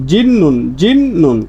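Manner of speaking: জিন্নুন repeated slowly with a clear doubled n; drawn-out জিন্নুন, twice; slow correct pronunciation. Shown as a demonstration. Teacher drilling the word for students, slowly. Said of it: "উচ্চারণ করার সময় এরকম করবেন".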